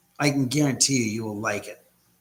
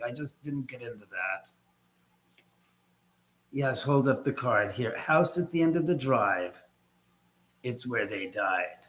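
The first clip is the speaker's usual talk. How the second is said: ordinary talk, nothing marked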